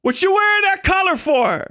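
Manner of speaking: shouted street-beef challenge; street tough yelling. Pretend. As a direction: bark the challenge like a street thug. Acted street confrontation, repeated.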